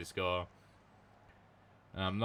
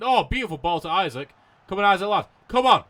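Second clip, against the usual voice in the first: rising, louder excited urging; excited cheering; 9 semitones higher, 11 dB louder than usual. Real, not acted. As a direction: cheer the player on, getting higher. Urging player on during attack.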